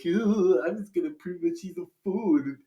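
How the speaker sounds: put-on bratty dingus voice, rushed; mock a nasty brother in a silly voice; mocking Edmund. Edmund plotting to pick on his sister, mocking. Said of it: "a complete dingus".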